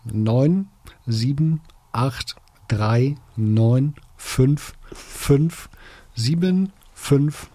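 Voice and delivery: slow, one digit at a time; measured digit-by-digit readout with pauses; slow dictation. Real, not acted. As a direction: read it out slowly for someone writing it down. Reads out ISBN digit by digit.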